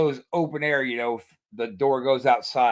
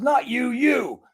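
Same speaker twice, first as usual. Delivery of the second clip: high, emphatic correction; retold sharp, raised-pitch order; imitated exasperated cop; 10 semitones higher, slower than usual. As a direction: act out a cop singling someone out.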